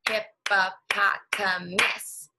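hippopotamus drawn out very slowly, syllable by syllable; stretched out. Shown as a demonstration. Teacher stretching hippopotamus so kids hear the syllables. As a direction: say hippopotamus slow so each syllable shows. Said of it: "It has a lot of syllables".

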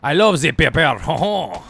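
hammed-up French with a jolly chuckle; badly faked French accent, drawn out; mock French accent. Streamer trying accents about France, exaggerated mock French. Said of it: "How many accents can I do?".

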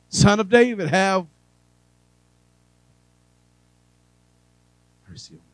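loud, high cry of Son of David then a faint mercy on me; a beggar crying out for mercy; begging plea. Acted. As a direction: beg out loud, then collapse into a whisper. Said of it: "appeal to the mercies of people".

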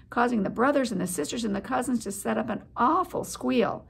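ordinary delivery

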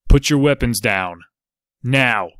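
loud sharp order; a hidden ranger ordering the men to disarm, loud command; commanding shout. A narrator's reading. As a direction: bark the order to drop weapons.

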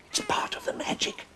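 breathy secret whisper; the old man whispering about the magic. Acted. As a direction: let them in on a secret, whispered. Secret aside to the children.

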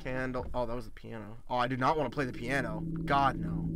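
low, very soft muttering; hushed dread. Real, not acted. Player refusing to play the piano, hushed dread. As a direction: keep it down, dreading what's there.

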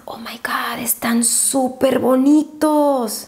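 slow, breathless whisper of delight; awed whisper. Real, not acted. Vlogger admiring highlighter swatches to viewers, awed whisper. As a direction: whisper it in drawn-out amazement.

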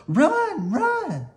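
very high, loud frantic shriek; panicked. Acted. Melissa shrieks at Annie to flee the cat, panicked. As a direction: scream at her to run.

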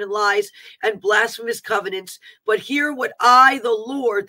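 emphatic declaiming, hitting each phrase's opening; prophetic warning. Acted. Warning against seducing spirits on the airwaves, declaiming. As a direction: denounce it like a preacher.

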